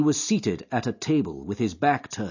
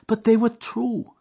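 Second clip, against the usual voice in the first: put-on high, louder anguished girl's voice; frightened young woman; 13 semitones higher, 6 dB louder than usual. A narrator's reading. Etty fearing Jack is a criminal, high anguished voice. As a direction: plead with him, fearful for him.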